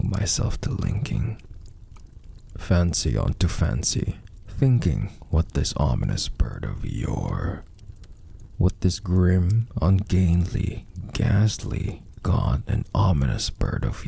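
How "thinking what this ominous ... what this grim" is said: plain reading voice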